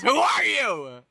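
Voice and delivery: slow, stretched challenge; drawn-out demand. Acted. Weapon pointed at the frog, drawn-out demand. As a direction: draw the question out. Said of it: "in English goes".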